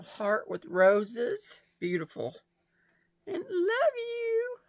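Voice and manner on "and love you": choked up, barely getting the words out; real tears breaking through; crying. Real, not acted. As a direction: voice breaking high into a sob. Choked up reading friend's card.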